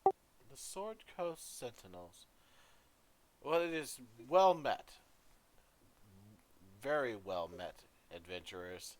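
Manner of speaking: a gracious dwarf queen, warm welcome. Acted. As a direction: greet the guests broadly. GM voices the queen.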